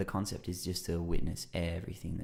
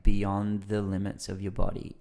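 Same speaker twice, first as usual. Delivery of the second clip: normal talking voice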